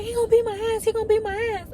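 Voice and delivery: a hint of a panicked girl; frightened girl. Girl repeats threat.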